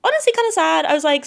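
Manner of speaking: ordinary talk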